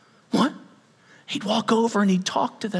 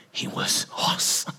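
awed whisper; 8 dB quieter than usual. Real, not acted. Whispered in awe.

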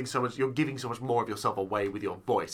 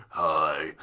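creepy low greeting; 10 semitones lower than usual. Retold or imitated. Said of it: "it's always".

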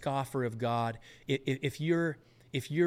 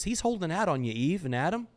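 ordinary talk, nothing marked

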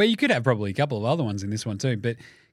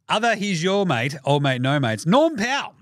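ordinary talk

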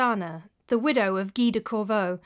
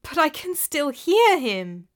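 insistent; 6 semitones higher, 3 dB louder, slower than usual. A narrator's reading. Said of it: "she snapped".

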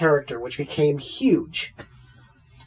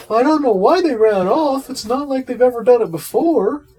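clueless dog owner; 8 semitones higher, 5 dB louder than usual. Retold or imitated. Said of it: "they're like".

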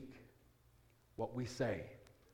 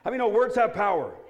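higher, louder question through a laugh; laughing call; 8 semitones higher, 10 dB louder than usual. Real, not acted. Pastor laughing as he asks the congregation, loud. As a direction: toss it out laughing to the crowd.

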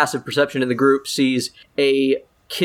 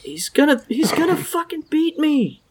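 put-on frightened kid; 11 semitones higher than usual. Kid pleading about father.

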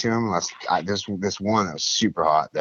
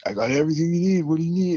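much higher, slick dealer pitch; street dealer; 9 semitones higher, much faster than usual. Retold or imitated. Dealer on a bike pitching drugs to the newcomer, higher and slick. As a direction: sell it like a friendly hustler.

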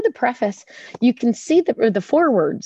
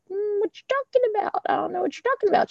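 much higher, softer, evasive mock innocence; playing dumb; 11 semitones higher, 4 dB quieter, faster than usual. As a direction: dodge the question in a high, soft, quick mock voice. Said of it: "I'm like".

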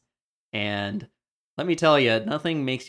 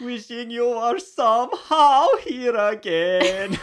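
high, slow, louder sing-song mock accent; mock Swedish accent; 12 semitones higher, 8 dB louder, slower than usual. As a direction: croon it like a hammed-up Scandinavian. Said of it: "She should have been like".

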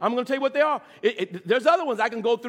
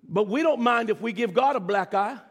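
usual speaking voice

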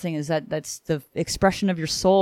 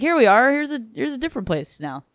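mock announcing; 7 semitones higher than usual. Host voicing the mentor showing the new place, higher mock-grand. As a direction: present the new place with a light flourish.

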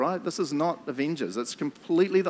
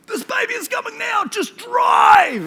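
high, loud screaming order; urgent scream; 8 dB louder, slower than usual. Retold or imitated. Wife in labour ordering her husband to drive, high scream. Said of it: "which is".

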